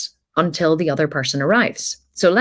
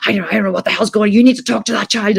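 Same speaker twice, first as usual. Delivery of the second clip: higher, louder, fast and fed up; exasperated outburst; 6 semitones higher, 5 dB louder, faster than usual. Retold or imitated. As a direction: blow up at the crying, rising louder. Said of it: "And so he was like".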